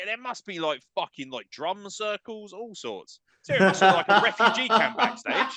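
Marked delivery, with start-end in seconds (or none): 3.4-5.6 louder, words breaking into laughter; can barely get the joke out for laughing. Real, not acted. Host joking about the crowded backstage, laughing loudly.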